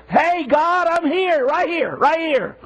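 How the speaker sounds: calling out for attention. Acted. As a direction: call out as if waving to be found. Calling out to God to be noticed.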